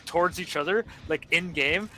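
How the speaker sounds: normal talking voice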